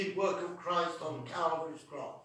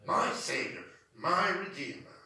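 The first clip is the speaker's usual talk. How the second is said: usual speaking voice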